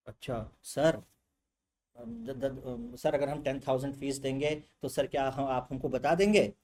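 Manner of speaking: low, stammering mock-student question; hesitant student. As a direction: ask timidly, tripping over the first words. Acts an awed, hesitant student.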